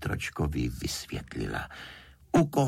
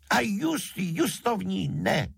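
high, stretched-out defiant cry; stubborn yell, pitch soaring; shouted refusal; 14 semitones higher, 3 dB louder than usual. A narrator's reading. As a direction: dig in and cry it out. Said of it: "křikl znova šejtroček".

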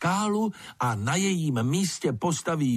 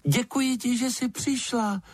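high, piping little bat; bat character voice; 13 semitones higher than usual. A narrator's reading. Bat thanking the lizard for coming, piping character voice. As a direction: give thanks in a tiny high-pitched voice.

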